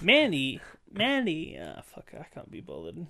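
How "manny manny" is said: loud, high calling of a name. Pretend. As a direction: call the name across the room.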